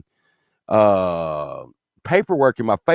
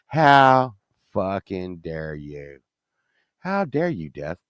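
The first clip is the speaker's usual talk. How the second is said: low, very slow indignation; seething anger; 8 semitones lower, 5 dB quieter, slower than usual. Retold or imitated. Patient on the operating table to Death, seething and low. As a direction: space it out word by word, pitched low. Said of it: "I got real mad".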